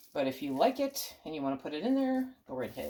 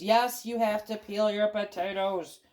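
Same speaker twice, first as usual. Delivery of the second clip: arguing with myself; 3 semitones higher, 3 dB louder than usual. Pretend. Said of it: "Stop arguing with me".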